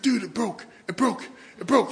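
high, rising, frantic repeats; panicked repeat. Retold or imitated. Friend telling him the stink bomb broke, high frantic panic.

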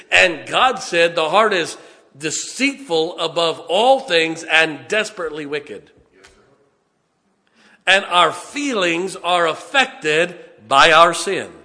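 slow, building pulpit delivery; forceful preaching. Real, not acted. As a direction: preach it loud, stretching the key words.

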